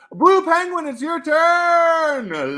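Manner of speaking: high, loud, slow announcer shout; announcer call. Pretend. Streamer announcing a viewer's turn loudly. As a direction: call the next player out big.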